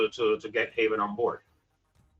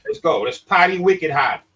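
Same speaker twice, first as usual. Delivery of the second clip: put-on Boston accent; 5 semitones higher, 7 dB louder than usual. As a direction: ham up a Boston party guy. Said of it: "what was that Boston accent?".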